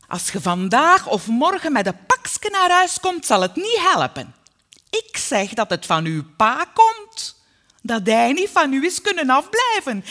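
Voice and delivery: loud, high, furious tirade; angry, emphatic maternal threat; threatening mother. A narrator's reading. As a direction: rail at full voice like a domineering mother. Mother threatens her daughter.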